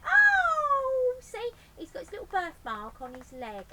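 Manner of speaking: high loud burst of joy; thrilled recognition; delighted Betty. Acted.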